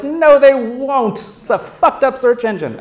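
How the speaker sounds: exasperated retort. Retold or imitated. Sceptic retorting the hype.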